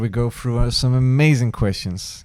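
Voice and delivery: big upbeat podcast intro read; announcer voice. Acted. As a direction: hype it up like an announcer. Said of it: "this is my announcer voice".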